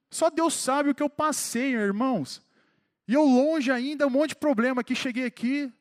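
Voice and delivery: rally the church like a revival preacher; fervent preaching. Acted.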